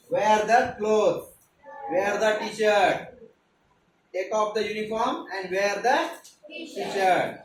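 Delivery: very slow, word by word; slow teaching drill. Shown as a demonstration. Teacher summing up the phrases slowly for the class. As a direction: spell out the whole sentence slowly. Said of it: "हो की नाही?".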